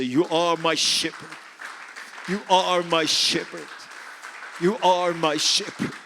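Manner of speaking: repeated fervent praise line; praise declaration. Shown as a demonstration. Pastor leading the church in praise, fervent. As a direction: declare it as praise, three times.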